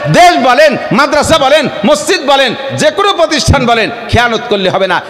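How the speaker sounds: rising, shouted call to the congregation; fiery preaching. Acted. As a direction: preach it hard to the whole crowd. Preaching at full force.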